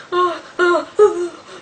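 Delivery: high, shaky frightened oh oh; frightened whimper. Retold or imitated. Scared girl alone in her room at night, whimpering. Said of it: "And I was like".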